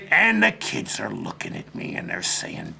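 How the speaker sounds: ordinary talk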